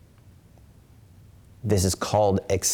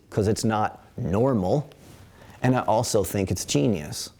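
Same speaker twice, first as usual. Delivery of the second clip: normal talking voice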